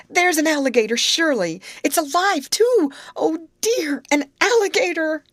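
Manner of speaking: high, loud panicked screaming; terrified cry. A narrator's reading. Grace crying out to the girls about a live alligator, terrified. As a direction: keep shrieking as if it's right there.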